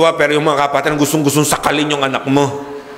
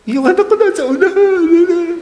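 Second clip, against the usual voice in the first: mock-proud parent's voice, pitched much higher and bright with delight; 3 dB louder than usual. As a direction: brag about your kid up front. (ginagaya ang tuwang-tuwang magulang)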